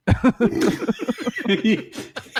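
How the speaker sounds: loud high-pitched laugh. Real, not acted. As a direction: crack up. Reacting to article line.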